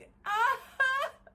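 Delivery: loud, high shrieking laugh; real high-pitched laughing fit; tickled laughter. Real, not acted. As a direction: burst out in a high, helpless cackle. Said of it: "That tickled me".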